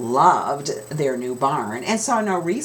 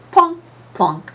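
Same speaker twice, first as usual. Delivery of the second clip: plunk sounds; 12 semitones higher, 6 dB louder than usual. A narrator's reading. Egg sound words.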